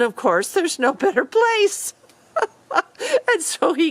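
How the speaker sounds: high, laughing through the words; can barely get the punchline out for laughing. Real, not acted. Teller laughing while finishing the story, high-pitched.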